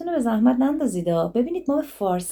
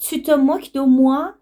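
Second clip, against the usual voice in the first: higher, slow, rising challenge; raised pitch, lifting at the end; mock indignant question; 5 semitones higher, 4 dB louder, slower than usual. Shown as a demonstration. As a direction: ask it as an affronted challenge. Acted reproachful question from phrase list.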